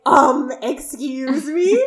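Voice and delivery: high, drawn-out incredulous question; mock indignant disbelief. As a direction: stretch it out, rising as if scandalized. Imitates mother shocked by son's remark.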